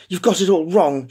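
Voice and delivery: urgent, breathless plea. Acted. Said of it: "she said breathlessly".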